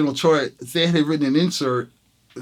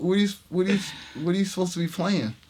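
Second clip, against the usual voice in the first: incredulous; 5 semitones higher, faster than usual. Retold or imitated. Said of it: "it was so, like, incredulous".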